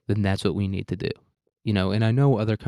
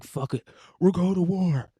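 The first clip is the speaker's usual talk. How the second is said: mock snapping leader; 9 semitones higher than usual. Leader finally snaps.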